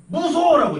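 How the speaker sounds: sudden high scared yell; child's scared shout. Retold or imitated. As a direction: shout it like a frightened little kid. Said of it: "내가 막 울면서 이렇게 소리를 질렀어".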